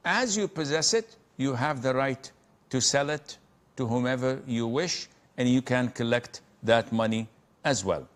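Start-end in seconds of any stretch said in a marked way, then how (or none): none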